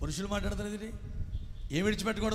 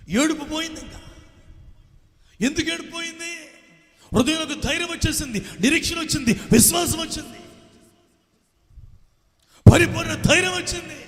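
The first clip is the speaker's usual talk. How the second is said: much higher, louder proclamation; triumphant preaching shout; 10 semitones higher, 6 dB louder than usual. Acted. Preacher proclaiming courage returned, triumphant shouting. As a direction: shout the victory to the church.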